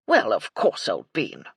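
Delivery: loud opening dropping low at the end; hearty old colonel. A narrator's reading. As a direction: bluff military gentleman. Colonel's bluff figure voice.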